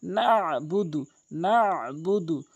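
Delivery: নাবুদু slow, আইন from the throat; correct Nabudu. Shown as a demonstration. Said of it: "আইনের উচ্চারণ হলকের মাঝখান থেকে". (নাবুদু ধীরে, আইন দিয়ে)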